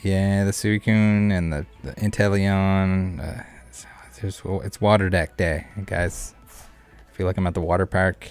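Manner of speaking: ordinary talk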